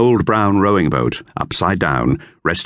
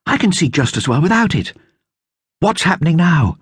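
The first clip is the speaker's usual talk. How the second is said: higher, lightly voiced girl; girl's voice, lifted higher; Dorothea; 10 semitones higher than usual. A narrator's reading. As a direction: read it as young Dorothea. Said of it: "she said".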